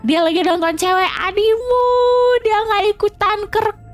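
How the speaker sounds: mock tattling. Streamer calls out to a friend's chat, mock tattling. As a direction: play the gleeful snitch calling to everyone.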